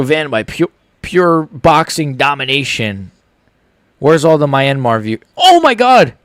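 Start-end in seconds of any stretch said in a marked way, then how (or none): 5.4-6.1 high excited exclamation. Real, not acted. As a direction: burst out in amazement. Excited reaction to the fight.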